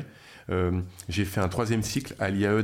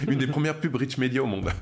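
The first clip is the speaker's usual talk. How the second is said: usual speaking voice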